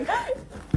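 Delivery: sharp high gasp of delight; excited gasp. Real, not acted. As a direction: gasp as if seeing treasure. Sees special edition book.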